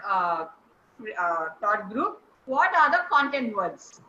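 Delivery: ordinary delivery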